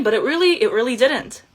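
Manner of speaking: ordinary talk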